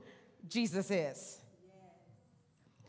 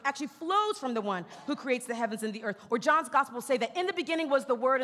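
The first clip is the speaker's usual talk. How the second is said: normal talking voice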